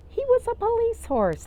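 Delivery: high, loud thrilled reveal; excited reveal. Acted. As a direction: announce it with delight.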